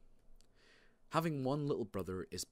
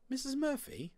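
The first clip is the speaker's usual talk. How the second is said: very high-pitched motherly question; surprised mum. A narrator's reading. Mum amazed at her son's fear, very high question. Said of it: "said Mum".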